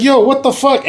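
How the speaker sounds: high-pitched incredulous protest; retold outrage. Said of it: "I was pissed".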